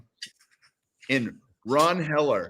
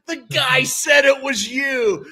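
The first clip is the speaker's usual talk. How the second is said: slow, higher, louder accusation; mock shout; 12 semitones higher, 5 dB louder, slower than usual. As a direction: yell the accusation at him. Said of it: "They're like".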